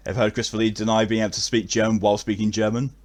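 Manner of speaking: ordinary talk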